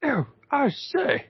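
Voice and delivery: affronted Victorian gentleman. Pretend. Old-timey gentleman voice.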